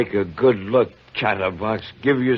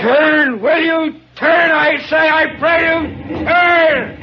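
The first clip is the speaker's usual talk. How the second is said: shouting higher and louder, begging the ship; a desperate pleading yell at the ship; 5 dB louder than usual. Acted. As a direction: bellow across the water, pleading. Chief yells at approaching ship.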